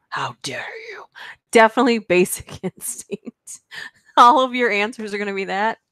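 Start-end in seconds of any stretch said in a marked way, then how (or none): 0.1-1.0 breathy, scandalised whisper. Pretend. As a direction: hush it out, mock-wounded. Mock outrage at a chat guess.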